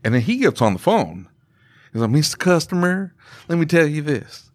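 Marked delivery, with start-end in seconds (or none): 2.1-4.5 slow, higher-pitched Southern drawl; smooth-talk a customer in a slow Southern accent. Retold or imitated. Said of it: "because he has the Southern accent".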